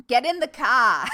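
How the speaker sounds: loud bad Boston accent. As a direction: bark it in a hammy Boston accent. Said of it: "my really bad Boston accent".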